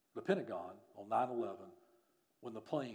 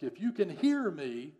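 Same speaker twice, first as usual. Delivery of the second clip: loud, raised call, stretched out; 7 semitones higher, 10 dB louder, slower than usual. Retold or imitated. Rescuer calls through the smoke to anyone trapped, calling out loudly. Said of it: "he called out through the smoke".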